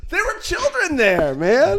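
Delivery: high, drawn-out incredulity; incredulous protest. Real, not acted. Storyteller appalled that kids watched, high incredulous protest. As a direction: stretch it out high in disbelief. Mock outrage at his own story.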